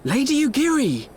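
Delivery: surprised call. Acted. Surprised greeting on arrival.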